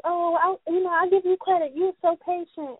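put-on high, sweet old-folks praise; grandparents' voice. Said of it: "They're like".

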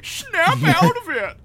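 loud, high, drawn-out celebrity take-off; slow, booming high impression. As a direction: stretch it into a hammed-up diva yell. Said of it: "That's my [name] impression".

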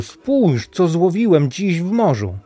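high, loud, excited announcement; calling out. A narrator's reading. Niels shows mother his catch from the doorstep, calling out. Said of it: "wołał już u progu".

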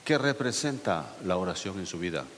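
ordinary delivery

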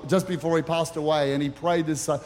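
usual speaking voice

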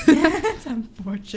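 laughing. Real, not acted. Laughing repeat of friend's line.

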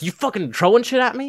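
high-pitched mock outrage; retold angry accusation. Teacher accuses the troublemaker of throwing, mock outrage. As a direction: imitate an indignant teacher confronting a pupil.

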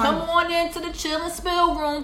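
high, loud host calling everyone in; sing-song welcome. Acted. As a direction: call the listeners in, sing-song.

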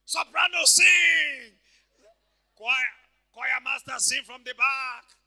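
loud, drawn-out calling from afar; full-voiced leader's yell; shouted choir command. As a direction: call orders to the choir from the back. Acts choir leader calling from back.

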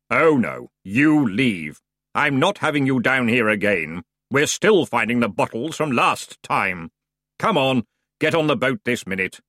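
bored underworld ferryman; grim ferryman voice. Acted.

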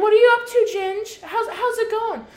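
high, loud fake-friendly voice, laughing; mock nosy Susan. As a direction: parody a prying hometown gossip.

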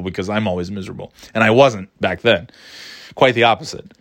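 normal talking voice

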